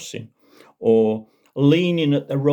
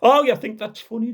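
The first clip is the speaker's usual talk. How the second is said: high, loud indignant challenge; offended constable; 12 semitones higher, 5 dB louder than usual. Acted. Constable rounding on laughing Jeremy, high indignant.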